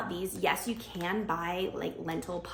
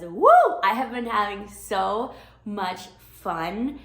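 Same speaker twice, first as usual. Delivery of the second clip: excited; 2 semitones higher, 11 dB louder, slower than usual. Real, not acted. Gushing about pregnancy cravings.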